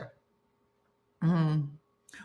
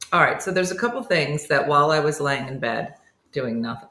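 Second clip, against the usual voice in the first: ordinary talk, nothing marked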